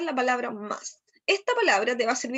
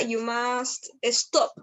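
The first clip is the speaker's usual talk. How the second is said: you must stop, stretched word by word; very slow model phrase; much slower than usual. Shown as a demonstration. Teacher modelling a safety warning very slowly. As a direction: drag it out so they hear every word. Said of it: "teacher modelling the phrase".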